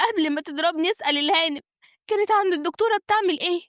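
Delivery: high, tearful indignant woman; aggrieved accuser. Acted. Slapped woman accuses the wife before her husband, aggrieved.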